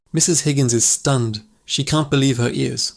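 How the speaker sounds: ordinary talk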